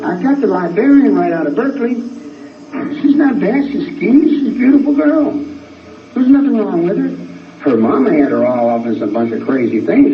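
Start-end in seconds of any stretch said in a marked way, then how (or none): 0.1-7.7 very high-pitched, worked-up insistence; emphatic high-pitched praise in protest; insistent defence. Real, not acted. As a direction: stand up for her, voice riding high. Insistently defending a woman follower.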